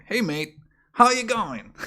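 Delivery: australijskie "Hey mate, how you going?", wysoko i głośno; zawołaj "Hey mate, how you going?" po australijsku, wysoko; demonstracyjne australijskie powitanie. Shown as a demonstration. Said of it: "This is how you pronounce it".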